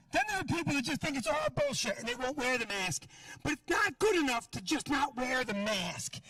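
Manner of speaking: ordinary talk, nothing marked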